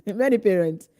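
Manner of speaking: laughing while repeating it; amused echo of the phrase. Shown as a demonstration. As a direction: say it again through a laugh.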